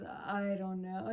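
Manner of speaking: low, soft, slow mumble; imitating a shrugging cop; mumbled reply. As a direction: mumble it like you don't care. Cop's mumbled reply retold.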